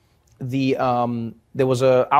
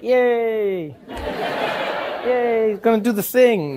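high-pitched pretend glee; hammed-up excitement; mock cheering; 7 semitones higher, slower than usual. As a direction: cheer as if absurdly thrilled. Sarcastic imagined cheer at police.

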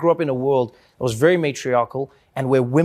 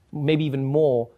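ordinary talk, nothing marked

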